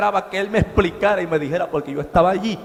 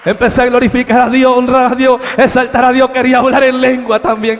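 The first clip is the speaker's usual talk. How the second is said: joyful praise; 5 semitones higher, 9 dB louder than usual. Retold or imitated.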